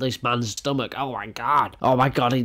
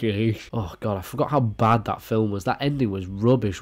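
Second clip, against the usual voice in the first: normal talking voice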